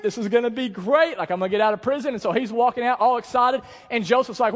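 high, loud, driving sermon voice; full-force preaching. Real, not acted. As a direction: preach it to the back row.

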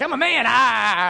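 put-on high, loud toddler roar; mock toddler yell trailing into "ah"; imitating toddler son. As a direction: draw it out into a little-boy battle cry. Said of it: "'cause he'd, like, grab".

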